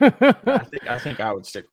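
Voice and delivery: laughing through the words; chuckling while answering. Real, not acted. Laughing at a tempting trade.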